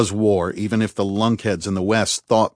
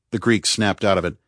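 ordinary delivery

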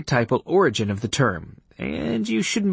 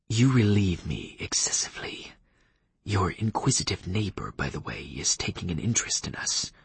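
whispering vampire; 2 semitones lower, 6 dB quieter than usual. Acted. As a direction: hush it like a watchful vampire. Leif whispers his warning.

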